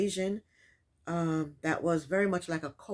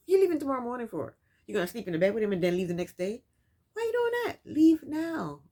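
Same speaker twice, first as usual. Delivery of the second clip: exasperated scolding; 3 semitones higher, 4 dB louder, faster than usual. Real, not acted. Scolding Jasmine on screen.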